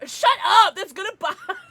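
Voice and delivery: loud high shout; playful shout. Real, not acted. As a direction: yell it at a dirty joke. Laughing at joke.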